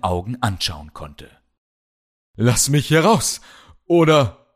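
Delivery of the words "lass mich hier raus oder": loud, high demand; angry threat. Acted. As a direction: bark the threat at him. Chained hero threatens captor.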